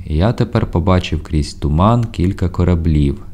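ordinary delivery, nothing marked

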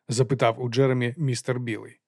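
plain reading voice

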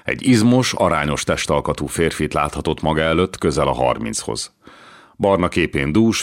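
ordinary delivery, nothing marked

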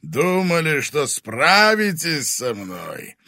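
menacing fire dragon voice; booming arrogant dragon. Acted. Dragon threatens heroes.

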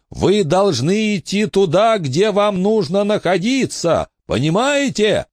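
high pressing cry; urgent shout. A narrator's reading. Billy urging the ghost to go where it belongs. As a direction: make him understand.

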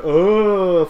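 long loud rising moan; Applejack. Retold or imitated. Applejack walking like a zombie, drawn-out mock moan. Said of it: "you got Applejack just like".

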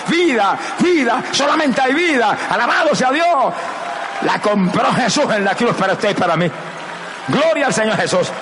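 high, driving pulpit delivery; rousing evangelist proclamation; full-force preaching. Real, not acted. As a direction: preach it urgently, building to a shout.

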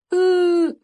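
very high, drawn-out bawl; child's pained wail. A narrator's reading. Boy wants his mother, crying aloud. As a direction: dissolve into a long whining sob.

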